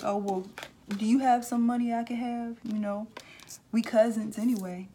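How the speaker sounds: high, loud wheedling plea for money; mooching cousin. Retold or imitated. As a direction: beg like a freeloading cousin. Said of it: "their face gonna be looking like this".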